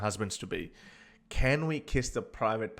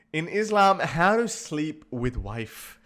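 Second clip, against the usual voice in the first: louder, higher, slow, drawn-out reading; mock search query; 4 semitones higher, 5 dB louder, slower than usual. Reading another search to viewers, loud and slow. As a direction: spell out the query in disbelief.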